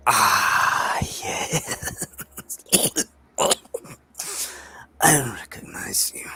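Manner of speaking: eerie breathy whisper of many eyes; creepy creature whisper. Acted. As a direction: hiss it like a monster that knows you. GM voices creepy eye creature.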